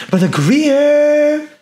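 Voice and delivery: high, very long held name call; stretched shout-out. Real, not acted. Streamer shouting out a supporter, long high call. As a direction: sail up high and hold the name.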